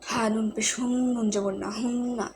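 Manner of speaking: হুন্না with the n hummed through the nose; nasal gunnah. Shown as a demonstration. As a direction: hold the ন in হুন্না as a long nasal hum. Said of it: "এবং নুনটা গুণ হবে".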